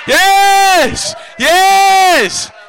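long, high drawn-out yell; exultant shout. Real, not acted. Preacher cheering on the laughing church, exultant shout. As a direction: holler it in triumph. Whooping up the congregation.

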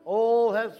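loud, rising preacher's prayer; fervent prayer. Acted. Pastor praying aloud over his church, loud fervent prayer. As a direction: pray over the people with full force.

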